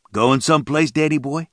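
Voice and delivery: lightly voiced thug drawling a taunt; drawled taunt. A narrator's reading. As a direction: lean on Danny boy, mocking. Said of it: "the man drawled".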